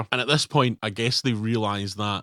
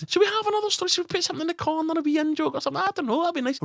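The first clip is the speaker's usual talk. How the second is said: silly squeaky spies; much faster than usual. Pretend. Spies plan a fake newspaper, very high squeaky mock chatter. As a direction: squeak it out like giddy kids on a craft day.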